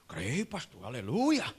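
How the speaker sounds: low mock-pious reply, swelling on aleluia; pious churchman. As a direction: play the devout believer, lightly caricatured. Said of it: "e ele todo espiritual". (crente devoto, levemente caricato)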